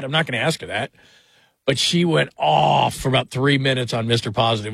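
ordinary talk, nothing marked

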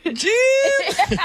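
very high, straining broadcaster voice; hammed-up strained call to a partner; Tony Romo. Mocking a commentator calling to his booth partner, strained high impression. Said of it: "Tony Romo on air".

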